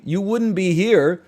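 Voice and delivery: ordinary talk, nothing marked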